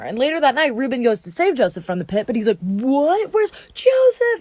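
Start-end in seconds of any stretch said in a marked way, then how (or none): 2.6-4.4 high, drawn-out cry of alarm; hammed-up dismay, voice leaping high on the name; distraught shock. Reuben finds his brother gone from the pit, mock distraught. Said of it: "he rips his clothes and he cries".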